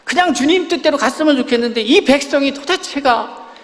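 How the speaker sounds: a lightly hammed-up Moses complaining; Moses pleading. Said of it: "모세가 또 하나님 앞에 가고".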